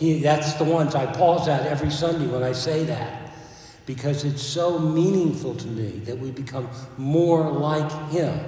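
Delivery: loud, earnest pulpit voice; loud heartfelt sermon voice; fervent preaching. Acted. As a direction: press the point home. Preaching at full force.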